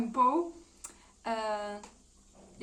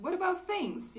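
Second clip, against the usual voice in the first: startled protest; 10 semitones higher, 3 dB louder than usual. Retold or imitated. Man protests as she leaves.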